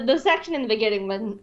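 ordinary delivery

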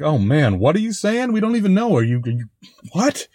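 playfully confused at garbled dub voice; baffled listener. Retold or imitated. Host mocks the unintelligible dub, high bewildered.

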